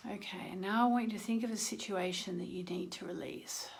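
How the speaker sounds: soft, hushed breathing guidance; guided meditation. Acted. Leading viewers through dark moon release breathing, hushed meditation voice. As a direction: guide them gently through the breaths, partly whispered.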